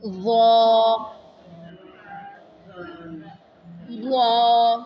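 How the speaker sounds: ظ drawn out heavy and full, lips not rounded; pronunciation demo. Shown as a demonstration. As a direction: say ظ thick from the tongue tip. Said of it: "وا جو ہے یہ". (ظ کا مخرج سے بھاری تلفظ)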